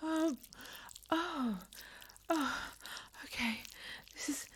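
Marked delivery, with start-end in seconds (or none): none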